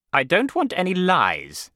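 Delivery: high, loud, slow and stern; forceful headmaster's warning. A narrator's reading. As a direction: lay down the law, slowly and loudly. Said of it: "he said".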